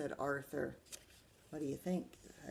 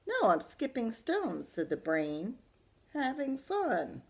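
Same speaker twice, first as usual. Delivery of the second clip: put-on higher, casual boy voice; the Brain; 6 semitones higher, 4 dB louder than usual. The Brain telling Buster he is skipping stones, high casual. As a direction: answer breezily.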